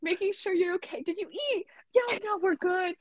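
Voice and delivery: high, sweet couple voice; married couple texting. Retold or imitated. Imitating their couple texts in a mock sweet spouse voice. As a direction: coo like a lovey-dovey spouse. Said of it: "We text like such a married couple".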